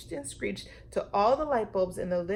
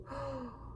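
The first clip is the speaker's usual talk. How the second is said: gasp; 5 semitones higher, 12 dB quieter than usual. Acted. Teacher remembers something.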